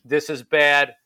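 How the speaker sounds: ordinary talk, nothing marked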